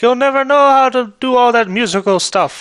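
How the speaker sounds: high, loud stern father voice. Retold or imitated. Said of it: "his dad was like".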